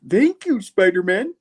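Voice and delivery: put-on high old-man thanks, echoed for a laugh; old man voice again. Repeating the nerd's thanks to Spidey as an old man. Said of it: "Kinda sounding like the old man".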